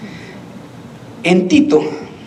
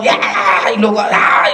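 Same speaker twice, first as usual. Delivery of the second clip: mock boss yelling out; wordless hollers of a bossy owner; imitated shouting. Owner yelling at everyone in the company, mock shouts. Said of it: "¿por qué le grita a todos?".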